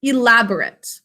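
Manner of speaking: ELABORATE ending in a short, unstressed "-rit"; adjective pronunciation. Shown as a demonstration. Teacher models the adjective for pupils, ELABORATE said "ee-LAB-uh-rit". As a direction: reduce the last syllable of ELABORATE to "-rit". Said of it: "we pronounce it a little differently".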